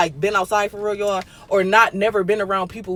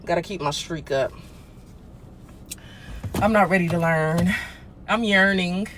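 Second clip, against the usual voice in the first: ordinary talk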